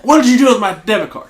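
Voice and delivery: loud, high mock-angry shout at the clerk; retold furious yell. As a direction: play the enraged customer yelling across the store. Customer storms back in furious.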